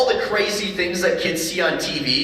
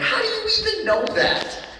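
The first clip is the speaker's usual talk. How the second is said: incredulous dad; 7 semitones higher, slower than usual. Retold or imitated. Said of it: "I'm like".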